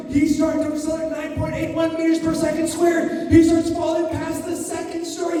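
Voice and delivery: high, rising breathless thrill; excited falling narration. Shown as a demonstration. Boy falling from window, excited narration.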